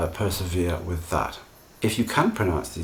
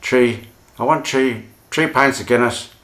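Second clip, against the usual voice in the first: put-on Irish accent; 5 semitones higher, 8 dB louder than usual. Teacher playing an Irish drinker at the bar: hammed-up Irish accent. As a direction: ham up an Irishman ordering Guinness. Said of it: "I hope that doesn't sound too stereotypical".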